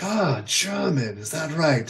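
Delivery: high, drawn-out "Ah, German" in mock curiosity; imitated intrigue. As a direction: play the promoter pricking up his ears. Acted promoter intrigued by German background.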